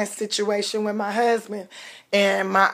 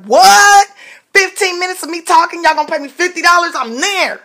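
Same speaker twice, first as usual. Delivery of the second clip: high, loud, fast thrilled squeal; mock excited disbelief; 8 semitones higher, 5 dB louder than usual. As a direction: play the giddy newbie who can't believe the pay. Excited retelling of being offered pay.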